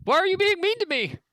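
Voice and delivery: high frightened bunny voice; scared. Acted. Scared rabbit folk quoted.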